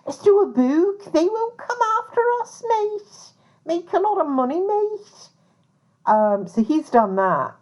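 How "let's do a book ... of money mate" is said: put-on much higher, cheeky Liverpudlian accent drawing out mate. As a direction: play a Scouse schemer in Liverpudlian accent, pitched way up. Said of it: "I think I got it just right, that Liverpudlian accent".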